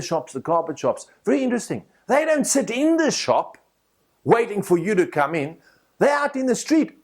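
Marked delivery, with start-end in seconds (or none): none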